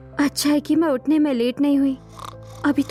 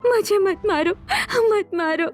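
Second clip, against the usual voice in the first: crying; 2 semitones higher than usual. Acted. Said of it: "रोने लगी".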